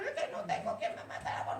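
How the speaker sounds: high, whispered mock whine with a laugh; mocking whiny excuse. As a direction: mimic a lame excuse, laughing at it. Mocking the sick man's excuse. (imitación burlona y quejumbrosa, susurrada y aguda)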